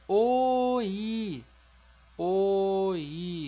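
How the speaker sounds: oi as o then i, each in its own beat; oi split cleanly in two; Korean oi. Shown as a demonstration. Teacher modelling the Korean word for cucumber. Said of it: "como é que a gente fala pepino".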